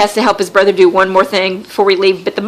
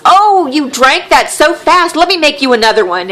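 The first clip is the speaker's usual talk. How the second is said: sweet mom voice; 6 semitones higher than usual. Retold or imitated. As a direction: gush at the empty glass like a doting hostess. Imitating mom offering another drink.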